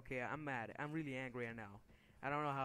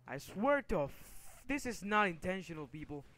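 raised, higher exasperated protest; genuinely angry; 4 semitones higher, 4 dB louder than usual. Real, not acted. Gamer telling viewers the anger is not staged, genuinely angry. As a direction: insist through real anger, pushing the pitch up. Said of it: "This is really me getting fucking angry".

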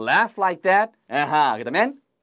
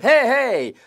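louder, higher "hey" to get attention; raised-voice acted warning call; 6 semitones higher, 6 dB louder than usual. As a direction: call to someone to stop them. Acted calling out to jaywalker.

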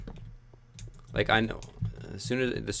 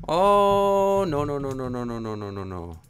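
panicked no; 6 semitones higher, 5 dB louder than usual. Real, not acted. Gamer panicking as something goes wrong, fading wail. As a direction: panic that trails off into defeat.

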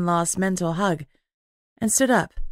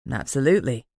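low, even and respectful male voice; 6 semitones lower than usual. A narrator's reading. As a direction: keep the anger under a polite low tone. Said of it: "his tone still even and respectful".

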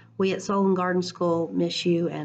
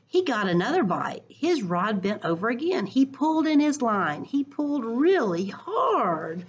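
expressive picture-book read-aloud; reading to children; 3 semitones higher, slower than usual. Acted. Teacher reading a picture book to her class, lively storytime voice.

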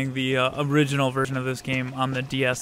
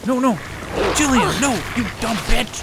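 angry yell; 9 semitones higher, 4 dB louder than usual. Real, not acted. Yelling at teammate Jillian in game.

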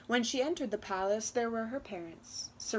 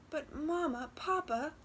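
pleading; 6 semitones higher than usual. Acted. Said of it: "Luba pleaded".

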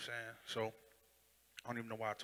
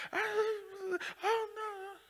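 high, tearful wail; crying mother; much slower than usual. Retold or imitated. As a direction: break down crying.

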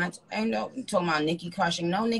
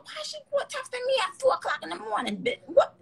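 high, fast mock outrage; retold high-pitched rant; mock outraged self. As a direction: squeal it out, scandalized. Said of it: "I said".

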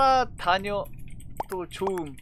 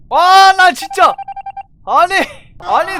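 exasperated yell; 6 semitones higher, 11 dB louder than usual. Real, not acted.

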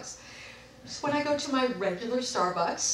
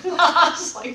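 ordinary talk, nothing marked

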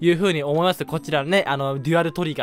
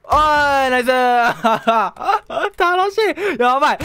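high, loud gleeful cheers with a laugh; real gleeful cheering; 7 semitones higher, 4 dB louder than usual. Real, not acted. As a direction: whoop at the screen. Cheering kills.

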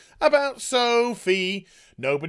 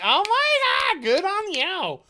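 very high, gushing airhead voice; mocking Mary as a squealing bubblehead; valley girl parody; 13 semitones higher, 4 dB louder, slower than usual. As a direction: gush like a ditzy sorority girl. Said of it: "Mary's just like".

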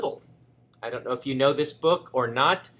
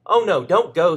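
louder, higher warning cry; alarmed cry; 4 semitones higher, 8 dB louder than usual. Acted. Henny Penny warning Cocky Locky, mock alarmed cry. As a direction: beg him to stay away. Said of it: "said Henny Penny".

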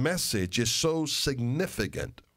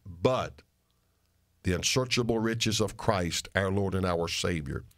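ordinary talk, nothing marked

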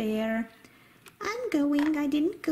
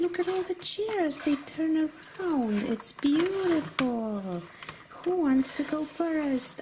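ordinary delivery

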